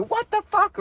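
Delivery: high, sudden retold yell; startled shout. Someone in the crowd yells as the fight starts, startled shout. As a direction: yelp it like chaos just broke out. Commotion erupts behind him.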